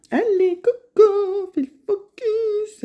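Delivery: loud, high sing-out to get attention; playful calling out. Pretend. Vlogger coaxing her camera to focus, high playful call.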